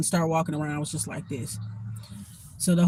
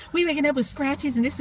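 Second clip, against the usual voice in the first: higher-pitched imitation of complainers; mock complaint; 8 semitones higher than usual. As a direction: mimic them in a raised pitch. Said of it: "That's what they told me".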